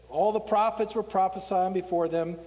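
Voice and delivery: normal talking voice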